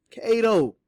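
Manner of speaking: high, loud, strained call. A narrator's reading. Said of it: "called out in a strained voice".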